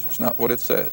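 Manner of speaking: a soft aside, that's not what it says; quiet flat correction. Acted. Preacher correcting a misquoted verse, quietly firm. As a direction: drop soft, firmly denying the misreading. Refuting wrong readings of verse.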